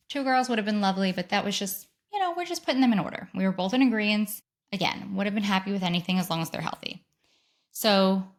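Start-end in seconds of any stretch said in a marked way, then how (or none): none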